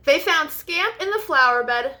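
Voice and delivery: usual speaking voice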